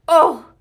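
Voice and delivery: loud, high grunt on landing; pained oof. A narrator's reading. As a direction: land hard on your back and cry out. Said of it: "said Leroy Ninker".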